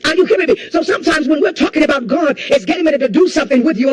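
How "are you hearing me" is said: normal talking voice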